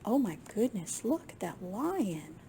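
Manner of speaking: ordinary delivery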